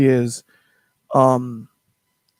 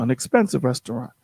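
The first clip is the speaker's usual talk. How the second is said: normal talking voice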